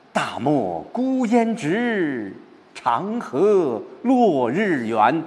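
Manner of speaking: very slow, high, sweeping recitation; 开阔地朗诵. Shown as a demonstration. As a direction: open out the verse with long held vowels. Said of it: "开阔了". (高昂舒展、开阔悠长的朗诵)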